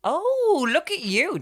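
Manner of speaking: high, loud teasing exclaim; teasing surprise. Real, not acted. Teasing her friend's sudden insight, high loud. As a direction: exclaim in mock amazement.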